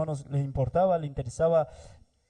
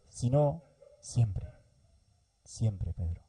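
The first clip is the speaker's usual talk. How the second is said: siempre muy lento; 4 semitones lower, much slower than usual. Acted. Insiste: perdonar siempre.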